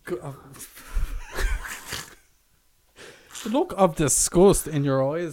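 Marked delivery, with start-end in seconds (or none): 0.9-2.0 squealing burst of laughter; high shrieking laugh. Real, not acted. Laughs at disgusted look.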